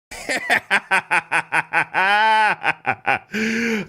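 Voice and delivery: high, long delighted laugh; cackling with glee; gleeful laugh. Real, not acted. Laughing at her contradiction.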